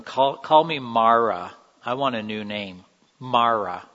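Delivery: slow, loud, bitter insistence; bitter demand. Acted. Naomi tells her neighbors to call her Mara, loud and bitter. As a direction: lean hard on every call.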